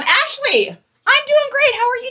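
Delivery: gushing greeting. Pretend. Seller greets viewer Ashley, in a high, loud gushing voice. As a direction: sing out an over-the-top cheery greeting.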